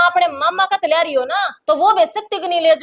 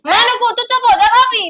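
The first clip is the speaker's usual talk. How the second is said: heated command; 6 semitones higher than usual. Acted. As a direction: shut the sister-in-law up, voice raised high. Acted quarrel, silencing sister-in-law. (चिढ़कर ऊँचे सुर में चुप कराना)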